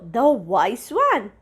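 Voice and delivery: ordinary delivery, nothing marked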